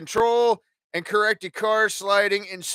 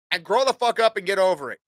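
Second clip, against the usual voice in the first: ordinary delivery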